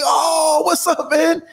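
high-pitched, delighted cry of recognition; excited greeting. Retold or imitated. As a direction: greet a long-lost buddy in a high excited voice. Reenacts recognizing an old friend.

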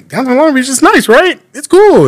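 high mock-naive voice of a newcomer. Friend mocks what newcomers always say, high and naive.

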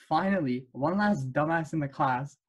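ordinary delivery

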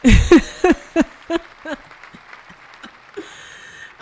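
real burst of laughter; loud laugh. Real, not acted. Laughs after the bit.

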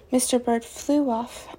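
plain reading voice

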